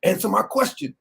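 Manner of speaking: mock gotcha interviewer. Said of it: "then ask you a question".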